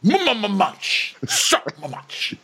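high, slow mock boasting; Austin. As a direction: stretch and stutter it out, hammed up. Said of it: "And Austin's like".

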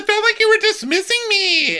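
high, loud wailing complaint; upset complainer. Retold or imitated. Upset cast member blames Heather, high wailing complaint.

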